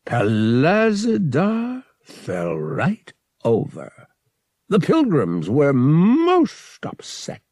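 high, slow alien priest, chuckling as he speaks; booming alien laughter. A narrator's reading. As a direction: tell it amused, like a laughing alien creature. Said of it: "booming with his species's version of laughter".

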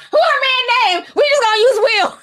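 high, cackling put-on quote through laughter; giddy laughing impression; laughing mock voice. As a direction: crack up while voicing her. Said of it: "I be like".

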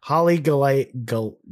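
ordinary talk

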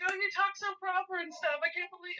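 put-on high, breathless gushing about the accent; mock starstruck squeal; gushing fan. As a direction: gush like a starstruck girl. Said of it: "She's like".

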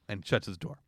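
ordinary talk, nothing marked

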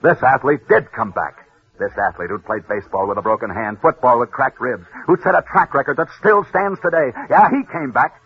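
hushed, solemn tribute over music; old-time broadcaster's memorial close; dramatic radio storytelling. A narrator's reading.

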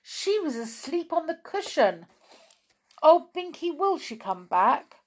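high, anxious little plea; alarm. Acted. Molly asking Binky about lost Whiskers, alarmed. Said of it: "said Molly in alarm".

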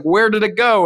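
high-pitched mock bafflement; baffled husband. Husband finds the house is gone, baffled.

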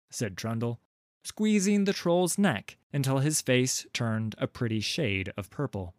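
ordinary delivery

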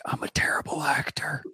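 confiding stage whisper; imitated conspiratorial whisper; John Malkovich. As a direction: lean in and whisper a secret confession. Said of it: "Malkovich leans over and is like".

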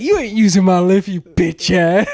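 trash-talking coworker. Retold or imitated. Coworker insulting the old man in the warehouse fight. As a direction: taunt him mercilessly. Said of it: "He's like".

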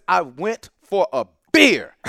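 slow, hammered retelling of a quote; slow and emphatic. Retold or imitated. Professor quoting the new director to radio listeners, slow and emphatic. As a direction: draw out each word, punching "beer". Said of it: "I wanna say this slowly and emphatically".

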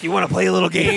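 lightly put-on movie villain voice, quick; playful taunt with a laugh near the surface; Saw villain impression. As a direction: tease it out like a mock horror villain. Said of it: "It's like fucking Saw".

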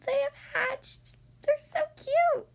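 high, slow adoring coo. Acted. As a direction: coo over the babies. Pig adores the chicks.

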